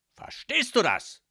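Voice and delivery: high, loud barked question; stern father yelling for an answer; domineering demand. A narrator's reading. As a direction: shout it, insisting on obedience. Boss demands daughter's agreement.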